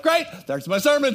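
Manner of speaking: sarcastic quip, pitched well up and loud; mock outrage at hearing his sermon stolen; sarcastic grumble. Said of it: "I'm like".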